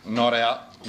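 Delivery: ordinary talk, nothing marked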